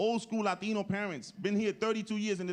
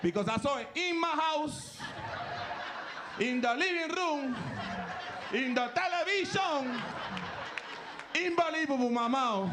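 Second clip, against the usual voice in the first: mock amazed dad; 7 semitones higher than usual. Imitating father's amazement.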